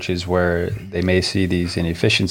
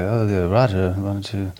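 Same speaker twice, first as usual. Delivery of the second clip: bad Swedish accent. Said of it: "That was a terrible accent".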